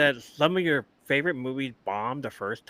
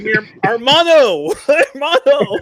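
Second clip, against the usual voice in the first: movie line shout; 10 semitones higher, 10 dB louder than usual. Retold or imitated. Friends quoting a movie, yelling it out loud. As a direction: belt out the famous movie line.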